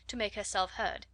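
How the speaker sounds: ordinary delivery, nothing marked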